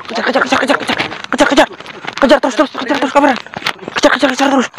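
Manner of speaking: breathless calling out during a chase; urgent chase calls. Real, not acted. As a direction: urge the cameraman on while running after him. Chasing the fleeing man.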